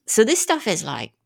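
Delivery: ordinary delivery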